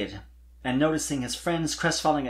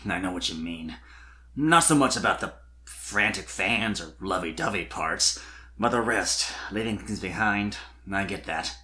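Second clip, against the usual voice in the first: Spike's voice; 5 semitones lower than usual. Acted.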